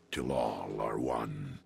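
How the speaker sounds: low, slow, steady vow; solemn motto. Acted. Signing off to Perceptor, low solemn oath.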